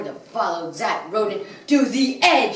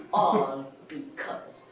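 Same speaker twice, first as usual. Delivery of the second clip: low, hushed, drawn-out words; slow dramatic punchline; 7 semitones lower, 10 dB quieter, slower than usual. Acted. Villain names the cause of his crime career, slow and hushed. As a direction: let the last line sink slowly and quietly.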